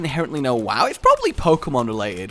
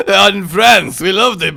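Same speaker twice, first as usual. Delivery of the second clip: put-on French accent; 6 semitones higher, 11 dB louder, slower than usual. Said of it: "I went a little bit French".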